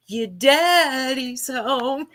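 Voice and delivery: lightly hammed-up imitation of someone whispering; mock whisperer. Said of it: "that's what it was when she's whispering".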